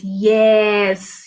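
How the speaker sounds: usual speaking voice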